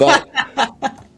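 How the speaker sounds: high, loud laughing word. Real, not acted. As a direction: laugh through it. Laughing mid-story.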